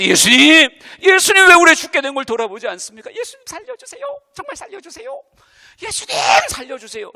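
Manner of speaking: loud, high desperate begging; begging cry. Retold or imitated. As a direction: cry out to be saved. Disciples begging Jesus again. (살려달라고 애원하는 제자들)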